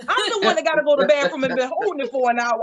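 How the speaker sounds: normal talking voice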